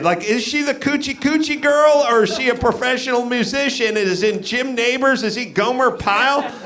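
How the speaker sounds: usual speaking voice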